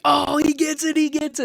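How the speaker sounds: high-pitched imitation of cheering fans; excited fans. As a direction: cheer like a stranger who loves it.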